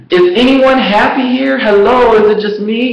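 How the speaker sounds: high, loud, incredulous; a loud, high cry of disbelief; bewildered. Retold or imitated. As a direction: call out in disbelief to the office. Self-quote of bewildered office worker.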